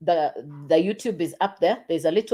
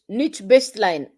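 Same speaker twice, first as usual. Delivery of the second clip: ordinary delivery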